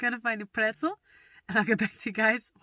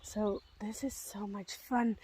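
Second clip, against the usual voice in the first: slow hushed whisper; slow whisper of delight; 8 dB quieter, slower than usual. Real, not acted. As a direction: whisper it so the woods stay quiet. Whispers in the woods.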